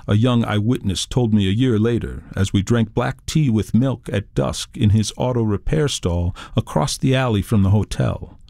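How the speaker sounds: ordinary delivery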